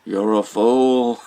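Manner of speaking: slow, drawn-out contempt; starving captain telling Chase he's a fool; scornful. Retold or imitated. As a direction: drag it out like a dying man's scorn. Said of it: "He's like".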